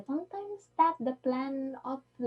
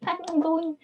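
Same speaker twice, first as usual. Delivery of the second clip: laughing; 7 semitones higher, 6 dB louder than usual. Real, not acted. Laughing while starting to answer.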